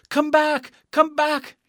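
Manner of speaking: high, loud urgent call; shouted. A narrator's reading. Dorothy calls after runaway Toto, shouting. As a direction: shout after someone running away. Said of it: "shouted Dorothy".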